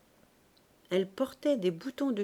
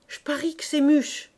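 higher, louder outburst; indignant exclamation; 7 semitones higher, 7 dB louder than usual. A narrator's reading. Shopkeeper guessing who lured her daughter away, crying out. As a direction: cry it out as a sudden realisation. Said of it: "s'écria la charcutière".

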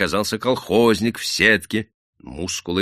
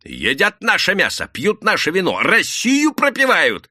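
high outcry, building louder; выкрик из толпы; 12 semitones higher, 5 dB louder than usual. A narrator's reading. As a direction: call out the grievance to the crowd. Angry shout from the crowd. (возмущённый крик)

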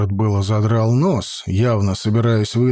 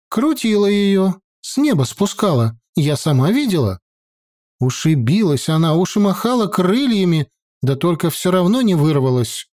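higher, bold peasant woman voice; feisty village woman; 7 semitones higher than usual. A narrator's reading. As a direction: push back boldly, higher. Woman describes.

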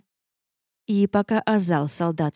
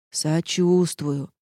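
slow, drawn-out fake sympathy; unsympathetic; much slower than usual. A narrator's reading. Castle answering the worried heroine, mock-sympathetic. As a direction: stretch it out without a drop of sympathy. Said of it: "без капли сочувствия в голосе".

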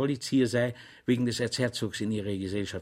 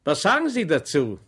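higher, louder gloating challenge; triumphant question; 4 semitones higher, 6 dB louder than usual. A narrator's reading. Official challenging Schweig, triumphant and loud. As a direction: ask as if you've already won.

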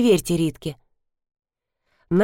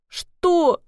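put-on small, high, tearful-child reply; trembling little voice; 10 semitones higher than usual. A narrator's reading. Upset girl answers Max, trembling little voice. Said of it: "дрожащим голоском откликнулась девочка".

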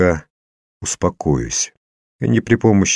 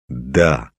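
usual speaking voice

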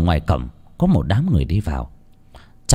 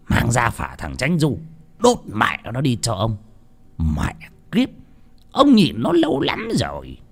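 spiteful order; 2 semitones higher, 3 dB quieter than usual. A narrator's reading. Old master ordering the rival's family record burnt, spiteful. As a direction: spit out the order.

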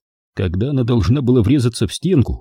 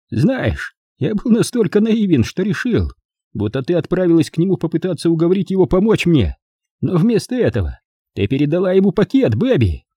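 high-pitched theatrical villain; taunting, accusing Mike; 8 semitones higher than usual. A narrator's reading. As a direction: lay out the accusation in a pitched-up role voice.

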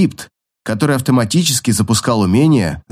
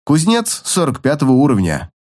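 plain reading voice

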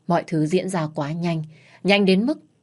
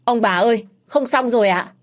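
high, loud panicked call from outside; panicked cry; 6 semitones higher, 6 dB louder than usual. A narrator's reading. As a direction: burst in shouting in alarm. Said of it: "tiếng thằng Lương hoảng hốt".

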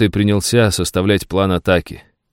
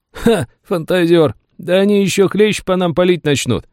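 mocking soldier; 11 semitones higher than usual. Soldier mocks comrade's hope.

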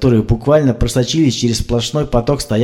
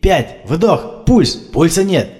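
frantic CPR; 6 semitones higher, much slower than usual. A narrator's reading. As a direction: keep pumping, voice climbing in despair. Frantic CPR counting and pulse.

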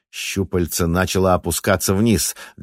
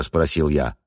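ordinary delivery

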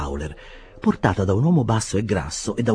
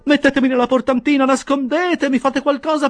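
much higher and louder frantic begging; panicked plea; 6 dB louder than usual. A narrator's reading. Half-dressed Winkle begging the bearers to hide him, frantic. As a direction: beg to be hidden, in a panic.

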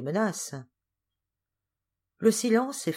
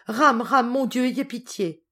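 high, loud cry for mercy; desperate plea; 7 semitones higher, 8 dB louder, slower than usual. A narrator's reading. Fainting niece begs God for pity, desperate cry. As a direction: cry out in distress, then weaken a little.

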